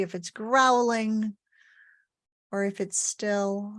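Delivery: calm body-scan guidance with long pauses; unhurried, evenly pitched meditation leader's voice; guided meditation. Acted. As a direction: lead listeners gently through the body, pausing between phrases.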